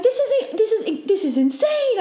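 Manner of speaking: high, stammered retold disbelief; amazed. Dreamer telling her companion of amazement, high and excited.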